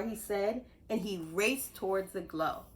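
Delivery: ordinary delivery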